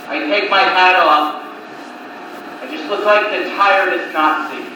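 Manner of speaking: ordinary delivery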